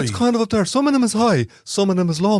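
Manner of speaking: high singsong Traveller accent. Shown as a demonstration. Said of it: "Give us a sample of it".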